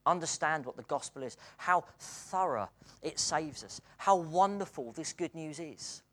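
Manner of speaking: ordinary talk, nothing marked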